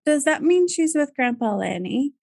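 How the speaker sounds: a hint of a child's hopeful question; retold little girl. Three-year-old asks mother about her late grandpa, lightly imitated child. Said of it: "And she goes".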